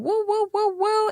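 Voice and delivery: high-pitched whooping cheer; sing-out "woo" cheers, very high; cheering mama. Retold or imitated. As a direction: whoop them on like a proud mama at a fashion show. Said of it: "their mama just cheering them on".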